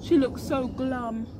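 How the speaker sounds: put-on slow, gentle read-aloud for children; storybook narration. A narrator's reading. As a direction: read it like a bedtime rhyme.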